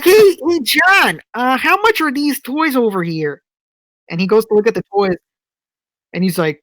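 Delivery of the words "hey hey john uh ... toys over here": calling out. Retold or imitated. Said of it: "She's like".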